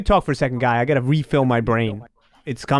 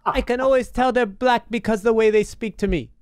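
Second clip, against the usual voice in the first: put-on Filipino accent; 8 semitones higher than usual. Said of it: "an impression of a Filipino lady".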